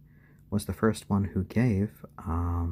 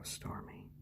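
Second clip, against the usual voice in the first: barely voiced whisper; 19 dB quieter than usual. Real, not acted. Whispering to Stormy as she dozes. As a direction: breathe the name softly.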